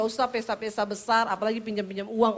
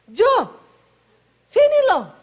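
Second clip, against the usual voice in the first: high, loud shouted call across the house; loud Sulawesi call; 11 semitones higher, 10 dB louder than usual. Retold or imitated. Wife calling her husband to come, high loud shout. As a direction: yell for the husband, pitch soaring high. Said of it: "Orang Sulawesi kalau ngomong nadanya tinggi terus".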